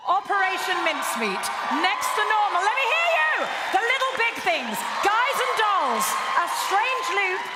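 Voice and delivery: high, loud shouted announcement; rousing roll call. Real, not acted. Host calls out the nominated companies, rousing shout. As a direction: shout each name to whip up cheers.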